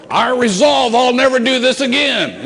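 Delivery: loud, high-pitched self-mockery; mock resolution. Preacher mocking his own broken promise, incredulous. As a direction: shout the vow in mocking disbelief. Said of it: "Two hours before".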